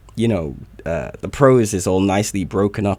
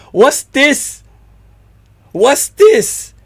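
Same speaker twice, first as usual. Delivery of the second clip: excited; 7 dB louder, much slower than usual. Real, not acted.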